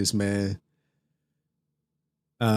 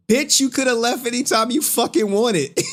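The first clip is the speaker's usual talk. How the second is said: much higher-pitched, incredulous retort; indignant outburst; 10 semitones higher than usual. Real, not acted. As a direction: fire back at them in high-pitched indignation. Mocking retort to people who left.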